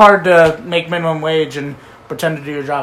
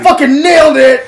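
mock triumph; 8 semitones higher, 8 dB louder than usual. Mocking the drift landing.

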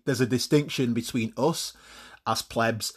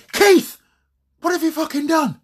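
much higher and louder, outraged; furious yell; 6 dB louder than usual. Acted. Owner shouting at Labrador over the mess, furious. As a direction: scream at the dog in disbelief.

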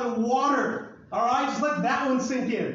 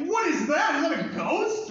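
higher, louder, hammed-up panic; mock alarm; 7 semitones higher, 3 dB louder than usual. Disciples seeing Jesus on the water, mock alarm. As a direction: play a spooked sailor, half joking. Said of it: "And they're like".

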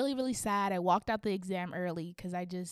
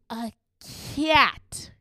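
loud, appalled repeat; outraged exclamation; 2 semitones higher, 6 dB louder than usual. Retold or imitated. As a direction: burst out on "cat" in disbelief.

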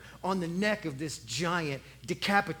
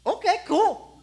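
high, louder offhand shrug; casual kid; 10 semitones higher, 6 dB louder than usual. Acted. Young David shrugs off being made king. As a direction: toss it off like no big deal.

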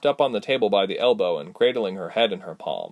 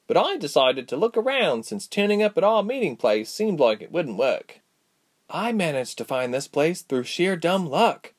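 put-on higher-pitched young heroine voice for Ilse; lilting, higher storybook character; Ilse recounting her journey; 8 semitones higher than usual. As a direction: tell the tale in a lifted character voice.

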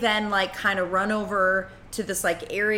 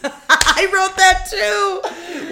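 laughing; 12 semitones higher, 7 dB louder, much slower than usual. Real, not acted. Laughing at shared joke.

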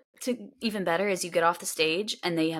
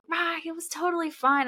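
breezy reassurance; 10 semitones higher than usual. Retold or imitated. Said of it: "she was like".

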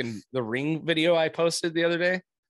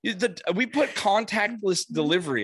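imitating son; 4 semitones higher than usual. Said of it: "And he's like".

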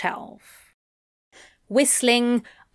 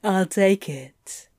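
slow, low amused purr; low chuckling demon; slower than usual. A narrator's reading. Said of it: "Crowley chuckled".

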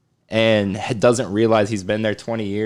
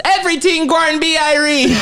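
much higher, hammed-up Jamaican accent; mock Jamaican accent; 14 semitones higher than usual. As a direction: parody Brad Pitt's bad Jamaican accent. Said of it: "and he goes".

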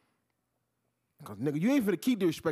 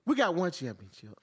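ordinary talk, nothing marked

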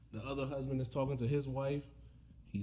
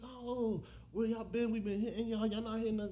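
excited mock couple; 9 semitones higher than usual. Lit couple calling out.

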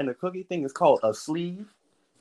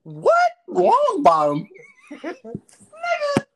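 high squeaky shocked what; shocked disbelief; 5 dB louder, much slower than usual. Real, not acted. Shocked at silly name.

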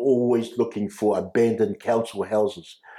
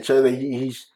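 usual speaking voice

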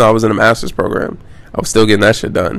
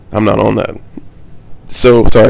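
speaking through hiccups; hiccuping between words. Real, not acted. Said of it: "I got the hiccups".

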